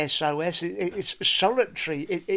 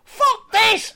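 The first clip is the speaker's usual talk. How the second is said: much higher, louder mock explosion; furious yell; 8 dB louder than usual. Baker losing it before throwing the sponge, mock furious yell. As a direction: blow up in rage. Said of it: "He goes".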